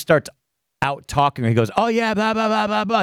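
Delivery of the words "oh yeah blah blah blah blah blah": higher, dismissive blah-blah; mock rambling. Mocking Aaron's babble to the girl on stream, higher and dismissive. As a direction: toss off empty chatter in a mocking voice.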